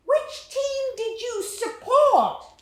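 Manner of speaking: put-on high, slow, loud British-accented boy; a small British boy asking politely, slow and high; British boy's voice. Little British boy asking the narrator about the game, high British accent. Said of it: "He had an accent. It sounded British".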